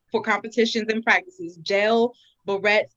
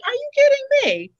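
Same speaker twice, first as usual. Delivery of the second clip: high exasperated disbelief; incredulous; 5 dB louder, faster than usual. Real, not acted. To co-host about double standards, high incredulous outburst. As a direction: shriek are you kidding me.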